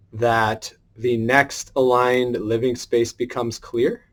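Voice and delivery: soft, slow spoken affirmations; slow, hushed affirmation voice; quiet intention setting. Acted. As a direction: read each intention slowly and softly, like a prayer.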